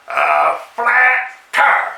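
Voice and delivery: slow exasperated repeat. Acted. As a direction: stretch it out, voice lifted. Repeated with higher drawl.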